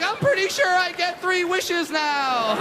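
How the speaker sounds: high, slow dreamy awe; hushed wonder at a magic creature; starstruck woman. Retold or imitated.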